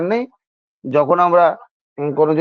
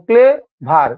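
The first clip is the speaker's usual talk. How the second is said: Clever split in two slow beats, clay, ভার; syllable by syllable; 4 semitones higher, 3 dB louder, much slower than usual. Shown as a demonstration. Teacher showing students clever takes two tries, slowly syllable by syllable. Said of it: "clever দুবার লাগছে".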